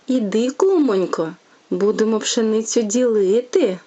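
lively, sing-song bedtime tale reading; storytelling to a child. A narrator's reading. Mother reading a fairy tale to her little one, sing-song. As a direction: read it like a fairy tale for a toddler.

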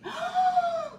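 high sharp gasp of delight; sudden high gasp. Real, not acted. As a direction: gasp as if you can't believe it. Shocked at the gift.

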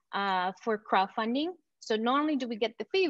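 ordinary delivery

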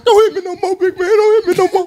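high, laughing imitation of a beaten man pleading; mock begging. Teller mocking the man he beat, high mock pleading. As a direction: beg for mercy, hammed-up and high.

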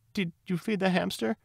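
very high, timid little voice; trembling. A narrator's reading. Neurosis at the window asks Lily Bunny, trembling. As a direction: tremble out the question in a high put-on voice. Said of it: "It trembled".

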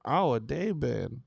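lightly voiced old grandma; a hint of an elderly grandmother; old lady voice. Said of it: "I don't know what this voice you doing".

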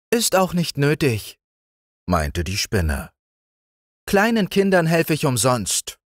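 sehr hohes, feines Stimmchen; Spinnenstimme. A narrator's reading. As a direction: als winzige Spinne antworten. (Spinne antwortet den Kindern mit sehr hohem Stimmchen)

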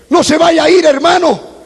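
ordinary delivery

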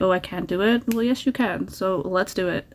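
normal talking voice

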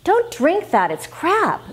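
put-on a sharp, high scolding at your kid; angry. Shown as a demonstration. Parent scolding a child over soda, angry. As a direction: snap at the child about the soda. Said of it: "If you say angrily".

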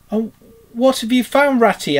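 high, loud excited question; eagerly curious. A narrator's reading. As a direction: ask a friend with eager curiosity. Mole asks after the cheering.

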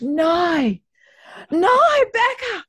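very high, loud Australian wail; hammed-up Aussie horror, shouted high; terrible Australian accent. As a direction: cry out in broad mock Australian. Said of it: "that's how I always do".

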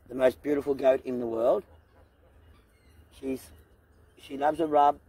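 ordinary delivery

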